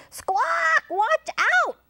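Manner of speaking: very high parrot alarm squawk; piercing storybook parrot. A narrator's reading. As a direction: screech a warning like a parrot. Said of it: "said the parrot".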